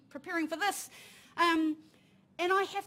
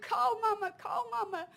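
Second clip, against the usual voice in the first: put-on higher, slow child voice confessing the fault; guilty little son; 6 semitones higher, slower than usual. Young son telling his mother it is his fault, slow and sad. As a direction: let a small boy own up, slowly and sadly. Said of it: "He goes".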